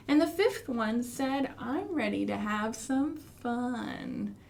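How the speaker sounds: rhythmic storytime chant; finger-play rhyme. Pretend. Teacher reciting a finger-play rhyme to children, rhythmic. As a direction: recite it like a nursery rhyme for toddlers.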